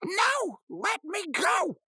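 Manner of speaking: very high, drawn-out mock plea; Rigby. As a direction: wail to be released in cartoon falsetto. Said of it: "then Rigby says".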